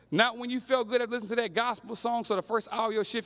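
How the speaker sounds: normal talking voice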